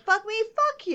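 high-pitched indignant retort; retold angry comeback. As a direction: throw it back, mock outraged. Retold angry comeback to rude customer.

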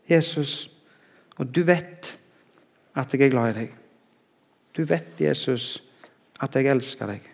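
soft, sinking retold confession; subdued, humble admission of love; capitulating. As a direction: surrender quietly, letting each phrase fall. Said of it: "så kapitulerer Peter".

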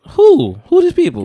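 high, loud playful demand; high-pitched, put-on disbelief; mock indignant challenge. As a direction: demand names in mock outrage. Mock challenge to unnamed doubters.

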